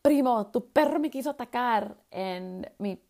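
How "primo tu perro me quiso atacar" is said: higher, louder, scared plea in Spanish; frightened plea to cousin. Retold or imitated. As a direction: tell your cousin his dog just went for you. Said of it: "I would tell my cousin".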